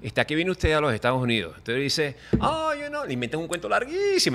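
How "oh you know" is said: high, light put-on voice; mock innocent excuse. To the immigration officer, a high mock-innocent excuse.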